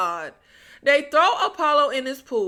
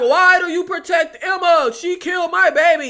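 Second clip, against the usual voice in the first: louder, higher retold protest; mock outcry; 4 semitones higher, 5 dB louder than usual. Hero to the women tackling him, mock outcry. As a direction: cry it out as the wronged father, hammed-up. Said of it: "screaming the name Emma at the top of his lungs".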